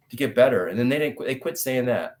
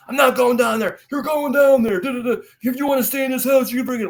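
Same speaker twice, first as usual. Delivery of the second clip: retold screaming match, pitched very high; screaming argument; 10 semitones higher, 4 dB louder than usual. Father and son fight over the job, screaming. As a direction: yell both sides of a father-son fight. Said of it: "Just screaming".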